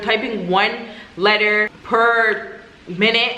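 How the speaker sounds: word by word, with spaces between; exaggeratedly slow. Real, not acted. Complaining about slow airport typists, words slowly spaced out. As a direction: drag it out to match the sluggishness. Mimics super slow typing with pace.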